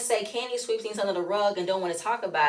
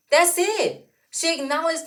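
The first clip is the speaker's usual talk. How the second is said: high, emphatic exasperation; indignant and higher; exasperated insistence; 5 semitones higher than usual. Real, not acted. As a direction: shut the argument down, pitched right up. Exasperated defence of Kandi.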